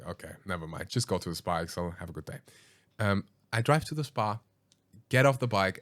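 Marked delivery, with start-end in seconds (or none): 0.0-2.4 low, soft, deflated muttering; quiet defeated sigh-talk; resigned mutter. Retold or imitated. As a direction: mumble it off, giving up.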